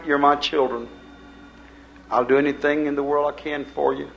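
ordinary delivery

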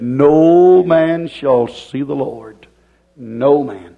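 very slow, with a long-held NO; drawn-out emphasis. Real, not acted. Preacher quoting the Bible on holiness, drawn-out emphasis. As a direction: stretch "No man" out for weight.

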